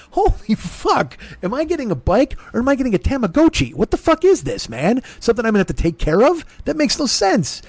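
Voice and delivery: high, exasperated disbelief; incredulous rant. Retold or imitated. Comedian mocking his own panic over a needy bike, high exasperated. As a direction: squeal it out in outraged disbelief.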